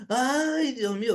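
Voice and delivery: high, drawn-out wail of "ay"; imitated pained cry. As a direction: mimic an old man crying out, clutching his chest. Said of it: "se agarraba el pecho y gritaba".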